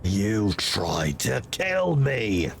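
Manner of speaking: deep, slow, accusing; stern commander voice. Acted. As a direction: loom over him with cold authority. Player character voice.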